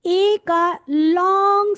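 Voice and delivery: teacher drawing out the vowel to show length; drawn-out long sound. Shown as a demonstration. Teacher to trainees, stretching the vowel slowly. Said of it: "कैसे बोला मैंने? Long.".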